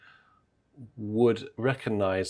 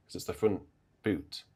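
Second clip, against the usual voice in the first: ordinary talk, nothing marked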